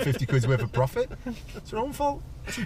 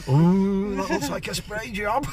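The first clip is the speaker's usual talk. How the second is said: impressed car fan; 8 semitones higher, 5 dB louder, slower than usual. Retold or imitated.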